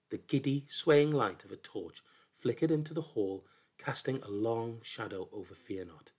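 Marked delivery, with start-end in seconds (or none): none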